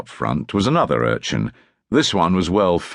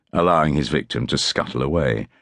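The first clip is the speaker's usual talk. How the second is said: ordinary delivery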